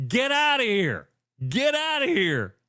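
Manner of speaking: high, pushing the player away; mock dismissive yell. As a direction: imitate the coach sending him off. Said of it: "you see Izzo go".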